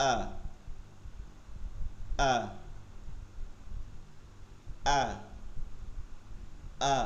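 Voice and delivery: "আ" like the Bengali vowel আ, from the start of the throat. Shown as a demonstration. Teacher drilling alif for students to match. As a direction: repeat "আ" clean and open from the throat. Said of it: "স্বরে আয়ের মতন".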